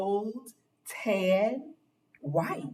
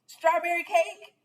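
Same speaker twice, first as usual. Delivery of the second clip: put-on playful old lady; 12 semitones higher than usual. Winked and chuckled.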